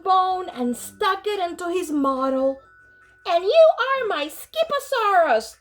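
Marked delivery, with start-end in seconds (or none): 3.3-5.6 delighted. Acted. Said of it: "he added out loud".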